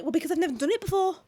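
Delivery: defensive retort. Retold or imitated. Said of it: "And I was like".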